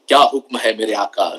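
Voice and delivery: deep, booming genie voice in Hindi. Retold or imitated. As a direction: play the servile lamp genie, deep. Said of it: "the genie says".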